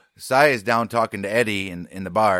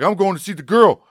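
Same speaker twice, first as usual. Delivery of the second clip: high, louder imitated declaration; defiant; 10 semitones higher, 6 dB louder than usual. Jane telling Farnham her plan, defiant. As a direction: announce it stubbornly in a put-on voice. Voicing Jane's defiant announcement.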